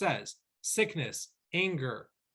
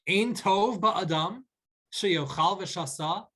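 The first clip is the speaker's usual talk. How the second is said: slow, rising, wondering read of "Ein tov ba'adam"; a puzzled wondering lilt, higher and slow; questioning tone; 3 semitones higher, slower than usual. Shown as a demonstration. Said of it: "to be read with a inquisitive tone".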